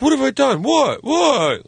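high girlfriend imitation; mock indignant protest.

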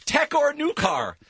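reversed words, said deliberately; backwards speech. Shown as a demonstration. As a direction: read the title backwards. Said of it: "I love this one backwards".

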